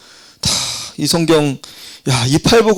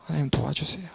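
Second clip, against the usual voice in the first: whispered plea to God; hushed whisper of a prayer for help; 14 dB quieter, faster than usual. Retold or imitated. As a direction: breathe the prayer, voiceless and pleading. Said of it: "목사님이 어떻게 했을까".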